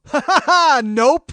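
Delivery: loud, high fake laugh, then a curt refusal; mocking laugh. Buyer refusing the scalper's demand, mocking laugh. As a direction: laugh right in his face. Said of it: "I laughed right in his face".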